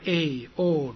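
A and O each held long; long, sustained A, then long O; drawn-out long vowels. Shown as a demonstration. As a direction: stretch out the vowels A and O. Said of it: "的拉长音".

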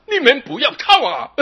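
usual speaking voice

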